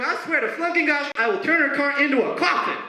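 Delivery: put-on high-pitched rushed kid voice; fast high imitation of a sassy girl; high fast mock kid. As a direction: squeak it out like a defiant child. Kid's outburst quoted in story.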